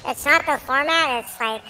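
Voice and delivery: sped-up, squeaky voice changer; chipmunk voice. Real, not acted. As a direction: talk through a chipmunk filter. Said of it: "the middle guy is using the chipmunk voice".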